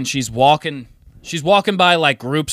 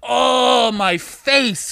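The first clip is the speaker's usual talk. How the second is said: put-on drunk whine; 10 semitones higher, much slower than usual. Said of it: "she was like".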